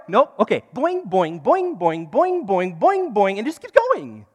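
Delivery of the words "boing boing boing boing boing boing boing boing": playful boing noises. Pretend. Imitating bouncing sound.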